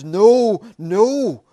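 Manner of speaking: loud stunned no; shocked denial. Acted. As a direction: it dawns on him in horror. Paul realizes the truth.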